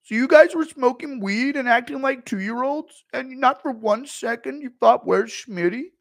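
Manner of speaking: high, very loud indignant rant; Schmitty rant. Retold or imitated. Streamer as Schmitty scolding partygoers, loud high rant. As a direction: yell it like a wounded ego.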